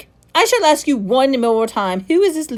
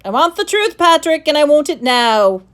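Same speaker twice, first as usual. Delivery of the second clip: stern demand; 7 semitones higher, 9 dB louder than usual. Acted. Headmaster demands the truth.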